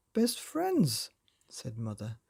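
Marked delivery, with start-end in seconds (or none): 0.1-1.1 gushing. A narrator's reading. Mother gushing over the pets.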